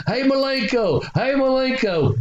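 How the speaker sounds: put-on high, calling old-lady voice; little old voice. Little old lady calling to the wrestler, high imitated voice. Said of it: "he heard this little old vo-, voice saying".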